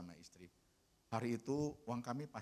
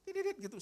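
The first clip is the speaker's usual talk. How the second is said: phone ring; 4 dB louder than usual. Retold or imitated. Bunyi handphone.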